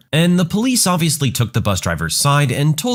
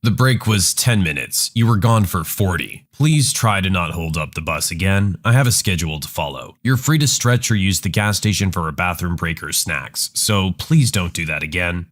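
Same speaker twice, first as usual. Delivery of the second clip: lower, firm bus driver, lightly voiced; a patient driver holding his temper, voiced low; annoyed bus driver; 6 semitones lower than usual. Driver scolds the mom.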